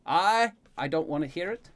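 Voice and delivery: a touch of an exasperated groan; groaning protest. A narrator's reading. Boy objecting to his bath, groaning. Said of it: "You groan".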